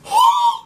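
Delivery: a sudden high gasp of disbelief; loud mock-shocked gasp; sharp shocked gasp. Real, not acted. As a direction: gasp as if scandalized. Reacting to the tears claim.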